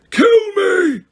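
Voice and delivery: very high, loud yell of despair; anguished yell. A narrator's reading. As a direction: cry out begging for death. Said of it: "he yelled".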